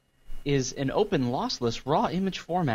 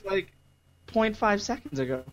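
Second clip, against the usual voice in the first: confused customer; 6 semitones higher than usual. Acted. Impersonating confused clubgoer.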